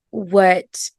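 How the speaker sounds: ordinary talk, nothing marked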